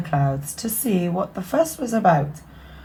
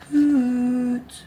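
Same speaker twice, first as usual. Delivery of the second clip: slightly braver owl call, higher; a little braver; 6 semitones higher than usual. Acted. Shy owl calling a little more bravely for his friend. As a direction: call out a bit more boldly. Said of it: "called out softly, but a little more bravely".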